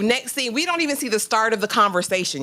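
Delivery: usual speaking voice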